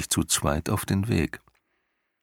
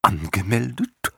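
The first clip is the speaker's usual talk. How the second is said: puzzled question; 3 semitones higher than usual. A narrator's reading. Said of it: "fragte der Nachtalb".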